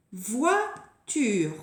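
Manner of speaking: "voiture" in two clear beats; separated syllables. Shown as a demonstration. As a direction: present "voiture" with a short gap before ture. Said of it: "Il faut repérer la dernière syllabe".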